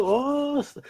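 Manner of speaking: normal talking voice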